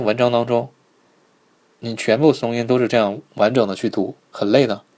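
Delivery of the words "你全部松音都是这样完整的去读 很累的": normal talking voice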